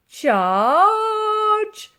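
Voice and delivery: high, loud charge call; old soldier crying charge; battle cry. A narrator's reading. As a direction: roar it like leading troops. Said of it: "cried Titch".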